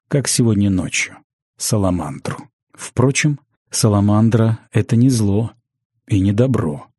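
plain reading voice

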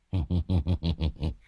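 low string of short chuckles; acted old rascal's chuckle; sly chuckle. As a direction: chuckle low at your successful trick. Đồng chuckles at his successful trick.

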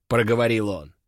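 ordinary delivery, nothing marked

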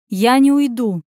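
defiant refusal. Acted. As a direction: stand your ground. Anya refuses to leave.

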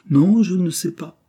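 high, loud, emphatic no; frank denial. A narrator's reading. Cyril answering the governess's questioning, frank and emphatic. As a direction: protest openly that you don't know. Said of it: "répondit franchement Cyril".

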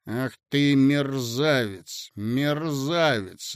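slow, separated cold words; a deliberate slow rebuke. Acted. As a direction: spell each word out. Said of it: "раздельно сказал юноша".

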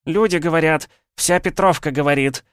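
high, loud brawling woman's voice, fists on hips; shrill village woman. Acted. Angry wife demands her missing man, high, loud yelling. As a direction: yell it at him like a market scold. Drunk woman confronting the hero.

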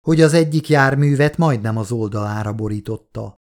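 usual speaking voice

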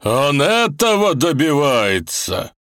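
slow, loud furious accusation; angry old man. Acted. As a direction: hurl the accusation in anger. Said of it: "гневно вопрошал старик".

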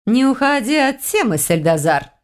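high-pitched, pestering character voice; needling lizard woman. A narrator's reading. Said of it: "заявила чешуйчатая заноза". (высоко, с подначкой)

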